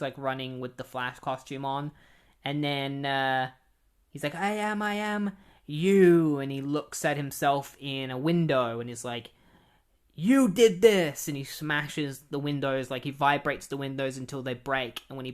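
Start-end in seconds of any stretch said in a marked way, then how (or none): 4.3-6.4 higher, louder, slow and strange; accusing his own reflection in an odd voice; clone confronting reflection. Retold or imitated. Clone facing himself in a window, slow and strange. 10.1-11.3 loud, slow, higher yell; angry outburst. Retold or imitated. Clone blaming his reflection, a slow loud yell.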